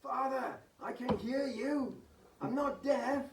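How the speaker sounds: high, loud, drawn-out call from below; young son calling through a door; young man calling out. Acted. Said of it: "young male voice again calling out".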